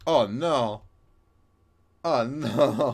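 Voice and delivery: dismayed oh no. Real, not acted. Dismay at game event.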